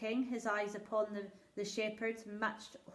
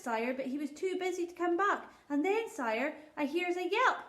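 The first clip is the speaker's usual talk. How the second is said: humble country shepherd, raised and eager; a poor shepherd pleading before his king; rustic old shepherd; 8 semitones higher, 5 dB louder than usual. Acted. Shepherd tells his tale.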